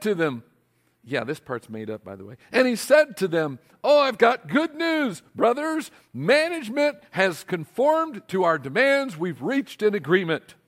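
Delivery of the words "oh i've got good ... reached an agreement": hammed-up announcement. Union rep telling the writers good news, excited and high.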